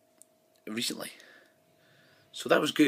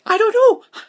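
very high, loud flustered squeak; mock panic squeal; panicked blank; 15 dB louder, slower than usual. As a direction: blurt it, mind gone blank. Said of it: "you're like".

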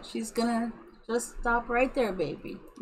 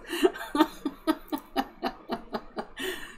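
long, high helpless giggle; drawn-out tickled laughter; giggling fit; 4 semitones higher, 5 dB quieter than usual. Real, not acted. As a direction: giggle on and on, breathlessly.

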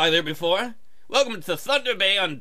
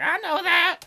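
high mock sob of the ex; hammed-up weepy high voice; mock crying ex; 10 semitones higher, slower than usual. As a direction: whimper it like a dumped girlfriend. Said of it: "She'd be like".

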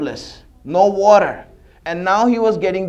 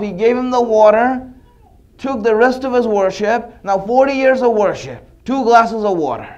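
ordinary delivery

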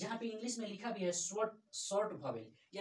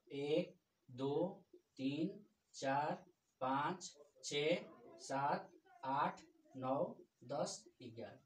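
slow counting; 3 semitones lower, much slower than usual. Real, not acted. Teacher counts vowels slowly.

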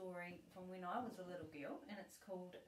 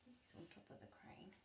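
whispered aside; 15 dB quieter than usual. Real, not acted. Grandma pointing at the picture for her granddaughter, whispering.

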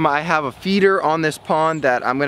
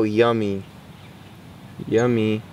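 usual speaking voice

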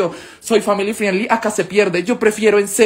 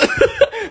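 risa corta. Real, not acted. Laughs mid mock prayer.

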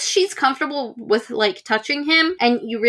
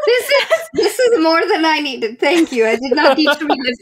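laughing; 4 semitones higher than usual. Real, not acted. Reacting to a cringe clip.

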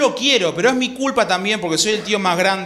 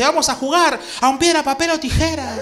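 Bombón's voice; 7 semitones higher than usual. Retold or imitated. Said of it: "se levanta Bombón y dice".

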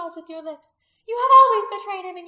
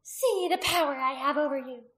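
loud, raised, malicious gloating; hissed; 5 semitones higher, 9 dB louder than usual. A narrator's reading. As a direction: gloat fiercely over a captive. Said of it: "she hissed".

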